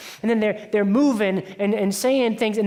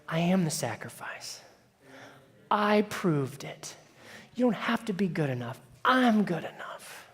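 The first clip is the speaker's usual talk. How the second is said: gentle God; 2 semitones lower, 7 dB quieter than usual. Acted. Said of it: "God says".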